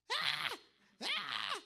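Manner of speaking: loud, yowling cat cries; imitated cat scream. Speaker showing how his cat screamed, loud cat yowls.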